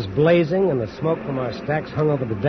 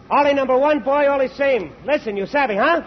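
calling out orders; 9 semitones higher than usual. Acted. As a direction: bellow simple pidgin orders down the line. Mate shouting orders to coolies.

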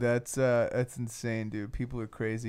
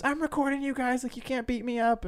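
high-pitched panicky imitation; mock freaking out; 12 semitones higher than usual. Man recording the guys who confronted him, mock freak-out. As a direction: mimic a man losing it, voice pitched way up. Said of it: "He was freaking out".

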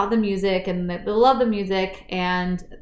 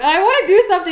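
frustrated Peter; 9 semitones higher, 5 dB louder, faster than usual. Retold or imitated. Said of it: "out of sorts 'cause he's like".

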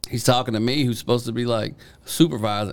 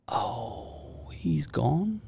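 mock quiet question; 9 dB quieter, much slower than usual. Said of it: "He goes".

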